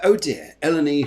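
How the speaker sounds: ordinary delivery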